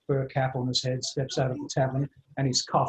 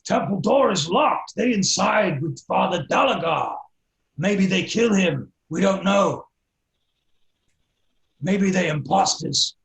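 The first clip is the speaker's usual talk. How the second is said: loud, slow, higher-pitched half-orc; raised voice, heavy drawn-out words; urgent half-orc; 5 semitones higher, 7 dB louder, slower than usual. Acted. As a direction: report bluntly in a half-orc's broken English. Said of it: "the half-orc tells him".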